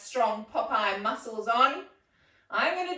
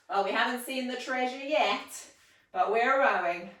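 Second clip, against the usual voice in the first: ordinary talk, nothing marked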